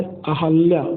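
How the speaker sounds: अहल्या with no इ after ह; correct pronunciation. Shown as a demonstration. Teacher models अहल्या for students, slowly and clearly. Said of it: "बल्कि अहल्या होता है".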